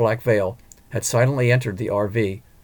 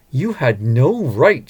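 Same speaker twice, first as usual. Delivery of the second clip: slow, louder seething accusation; 3 semitones higher, 5 dB louder, much slower than usual. Acted. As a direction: spit it out slowly in cold fury. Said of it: "She was seething".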